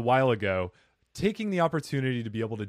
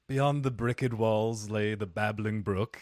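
slow, lower storybook recital; mock old English; 4 semitones lower, 3 dB quieter, slower than usual. Host answering the co-host's old English riff, mock old English. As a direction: recite it as mock antique poetry, unhurried. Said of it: "some old English".

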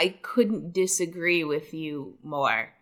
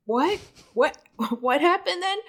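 mock surprise; 8 semitones higher than usual. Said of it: "I was like".

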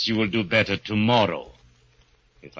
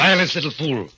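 stern rebuke; 7 semitones higher, 3 dB louder than usual. Acted. Petrov silences Gorgo.

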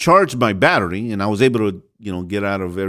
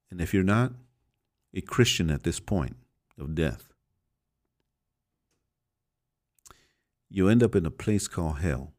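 solemn sermon; 3 semitones lower, 7 dB quieter than usual. Acted. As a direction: let each warning sink in quietly.

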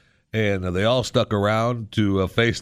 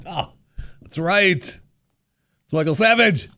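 mock dawning realization; 9 semitones higher, much slower than usual.

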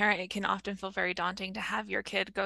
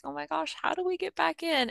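daunted horsewoman; 7 semitones higher than usual. Acted. Voicing riders returning after a break, lightly daunted. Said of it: "people who are in that stage".

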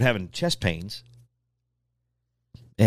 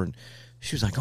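usual speaking voice

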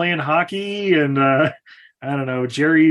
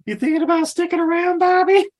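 ghostly mom voice; 14 semitones higher than usual. Retold or imitated. Mom's ghost voiced in retelling.

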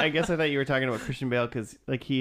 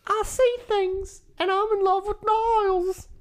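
Daphne impression; 4 dB louder than usual. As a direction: go up into a falsetto and play her dreamy. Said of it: "Just imitate Daphne".

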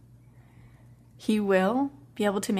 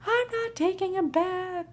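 put-on child refusing; 11 semitones higher than usual. Toddler refusing bath again.

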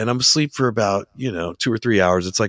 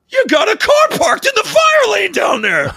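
high loud mock shout; guard yelling about the fire lane; yelling security guard; 5 dB louder than usual. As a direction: bark it like an angry guard. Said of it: "he starts yelling at me".

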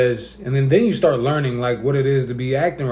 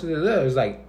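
usual speaking voice

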